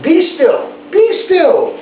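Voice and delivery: put-on high, firm little-boy command; Max commanding. A narrator's reading. High commanding kid's voice as Max tames wild things. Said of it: "till Max said to them".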